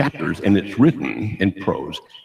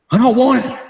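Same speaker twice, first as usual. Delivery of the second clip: panicked refusal; 7 dB louder than usual. Retold or imitated.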